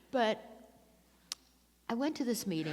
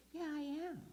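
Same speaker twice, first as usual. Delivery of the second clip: put-on a light, higher-pitched British accent; 10 semitones higher, 6 dB quieter, faster than usual. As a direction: do her in a funny British accent. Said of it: "in this funny British accent".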